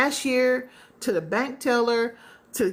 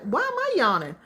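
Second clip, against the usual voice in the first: puzzled yawning complaint; 10 semitones higher than usual. Retold or imitated. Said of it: "You're like".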